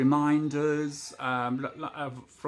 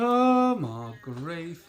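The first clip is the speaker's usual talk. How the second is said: exasperated; 3 semitones higher, 7 dB louder, much slower than usual. Retold or imitated. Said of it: "And I thought".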